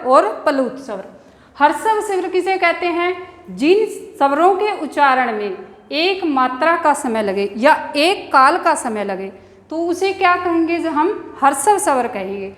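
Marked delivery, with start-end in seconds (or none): none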